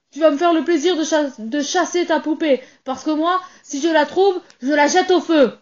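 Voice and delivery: father ordering the doll gone, angry and loud. Acted. Father threatens to burn doll.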